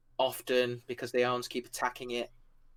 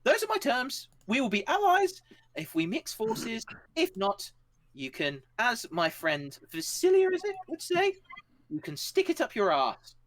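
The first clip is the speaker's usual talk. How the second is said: high cheeky ultimatum; 10 semitones higher than usual. Acted. Shah states terms, jokes.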